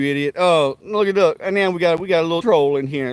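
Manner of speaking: normal talking voice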